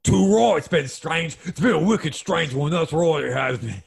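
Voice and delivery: hammed-up Cockney traveller; broad mock-Cockney chatter; bad Cockney accent. Traveller agreeing the London trip is strange, mock Cockney. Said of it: "picking up the Cockney slang".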